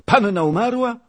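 high, loud cry of shock; aghast. Acted. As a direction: servant crying out at dreadful news. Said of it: "Oczy Rzędziana wylazły zupełnie na wierzch głowy". (okrzyk przerażonego zdumienia)

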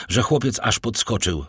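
ordinary delivery

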